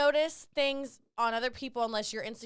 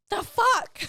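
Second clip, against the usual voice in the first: much higher, loud disbelieving outburst; stunned the fuck; 13 semitones higher, 9 dB louder than usual. Retold or imitated. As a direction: blurt it out in shocked disbelief. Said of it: "And you're like".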